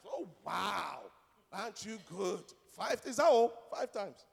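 loud mock amazement; hammed-up sarcastic admiration, drawn out; sarcastic awe. Pastor mocks a boast of forgiving five times, sarcastic.

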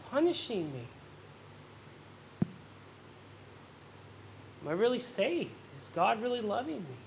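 a sick believer doubting quietly; worried inner voice. Acted. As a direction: ask it quietly to yourself.

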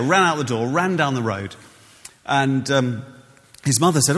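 ordinary talk

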